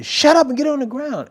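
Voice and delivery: loud, high-pitched forceful command; shouted police order. Retold or imitated. Police silencing the suspect, shouting. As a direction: yell him down like a cop.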